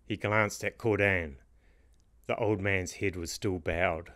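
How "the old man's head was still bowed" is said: plain reading voice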